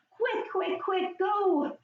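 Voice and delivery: quick, high, urgent call; urgent excited. Pretend. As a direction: hurry the kids along. Urgent superhero scramble.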